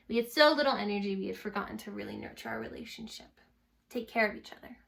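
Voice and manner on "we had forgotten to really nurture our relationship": plain reading voice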